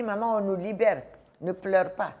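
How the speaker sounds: put-on child reassuring his crying mother; lightly voiced little boy; little son comforting. Voicing her five-year-old son.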